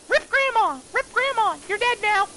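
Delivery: very high, loud squeal; squeaky mock send-off. Mock send-off of dead grandma.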